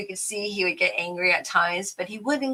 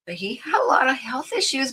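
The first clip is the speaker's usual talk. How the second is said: whispered aside; 6 semitones higher, faster than usual. Real, not acted. Aside while crafting.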